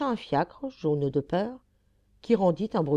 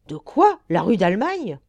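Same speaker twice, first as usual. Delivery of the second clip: abrupt exclamation; 2 semitones higher, 7 dB louder than usual. Acted. Mechanic suddenly notices the rue d'Allemagne, abrupt exclamation. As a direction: exclaim in sudden indignant surprise. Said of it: "poussa une brusque exclamation".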